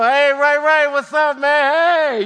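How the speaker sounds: loud, high, hearty call to a buddy. Pretend. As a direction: shout hey across the street to Ray Ray.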